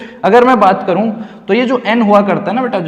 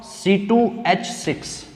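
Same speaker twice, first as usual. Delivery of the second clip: softer, slow, spaced-out formula; slow dictation; 8 dB quieter, much slower than usual. Real, not acted. Teacher repeating formula for students, slow dictation. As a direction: spell out C टू H six slowly, piece by piece.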